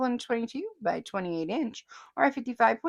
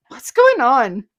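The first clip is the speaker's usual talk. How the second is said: much louder confused cry; baffled outburst; 3 semitones higher, 12 dB louder, slower than usual. Retold or imitated. Host reading a baffled comment, shouting it.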